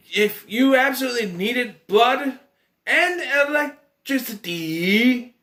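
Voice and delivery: high-pitched, drawn-out bafflement; sputtering, incredulous rant; exasperated disbelief. Real, not acted. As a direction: stretch words out in baffled protest. Ranting at a baffling plot.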